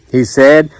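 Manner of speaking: ordinary delivery, nothing marked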